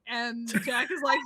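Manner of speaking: normal talking voice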